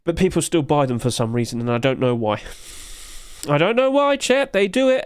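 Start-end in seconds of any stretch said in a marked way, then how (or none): 3.5-5.0 high-pitched bewildered wail; mock despair. Streamer to chat about people overpaying, high mock despair. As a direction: plead it high, hammed-up bafflement.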